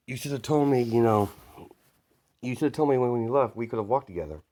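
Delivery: light indignant telling-off; mild scolding. Acted. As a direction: chide a friend who left without you. Jiro chides him for leaving.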